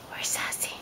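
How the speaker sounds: unvoiced, hushed question; whispering. Real, not acted. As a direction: whisper it playfully. Whispered repeat to baby.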